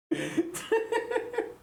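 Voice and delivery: squeaky high-pitched laugh; a giggle that jumps up high. Real, not acted. Laughing at chat feedback.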